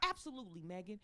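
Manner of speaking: very soft, dropping at the name; hushed agreement. Retold or imitated. Comedian agrees to Megan's favor, hushed. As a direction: agree quietly, as if in confidence.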